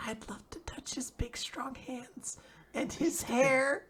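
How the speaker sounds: slow, high breath of a whisper; whispered longing. Retold or imitated. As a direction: whisper it like a shy secret confession. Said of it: "And she's just like".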